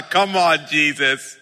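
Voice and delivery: ordinary talk, nothing marked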